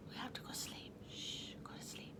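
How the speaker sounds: hushed unvoiced whisper, very soft. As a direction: shush them off to sleep. Hushing for siesta.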